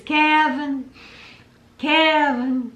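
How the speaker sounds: high, drawn-out country twang; country mom voice. Retold or imitated. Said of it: "hear his mom's voice in my head say his name".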